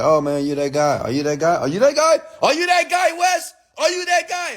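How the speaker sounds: a starstruck fan who can't believe it; excited fan. Retold or imitated. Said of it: "sometimes someone say".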